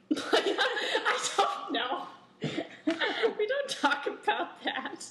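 high, loud laughing speech. Real, not acted. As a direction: laugh through the words. Laughing over her dad's question.

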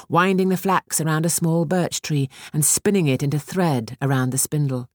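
ordinary delivery

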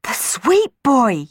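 excited yell. Acted. Girl yelling that the sweep boy is stuck, loud high shout. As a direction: shout the discovery. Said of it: "yelled Juliet".

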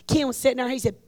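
normal talking voice

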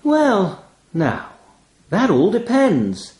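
high, slow and teasing; raven's voice. A narrator's reading. Raven toying with the narrator, high and slow. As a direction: savour the power you hold.

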